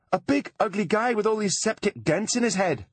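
high, hammed-up damsel voice; faked tearful plea. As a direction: keep the fake panic going. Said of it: "crying hysterically".

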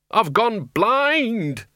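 high, drawn-out panicked scream. A narrator's reading. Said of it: "and then screamed".

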